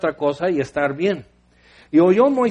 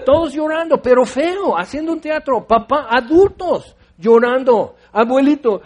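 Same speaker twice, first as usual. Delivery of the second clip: excited telling; 6 semitones higher, 5 dB louder than usual. Real, not acted. Describing the crying crowd excitedly.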